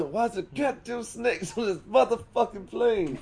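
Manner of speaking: high, loud action-hero yell; loud movie impression. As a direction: shout it like an outraged movie badass. Acted movie-quote impression, big shift.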